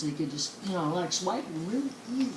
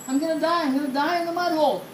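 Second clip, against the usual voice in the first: fast, higher-pitched mock panic; acted panic; 6 semitones higher, faster than usual. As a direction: rattle it off like a panicking horse. Sinking horse panics in a joke.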